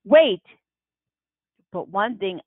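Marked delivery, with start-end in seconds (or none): 0.1-0.4 urgent call. Acted. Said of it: "Mama's grabbing at my leg saying".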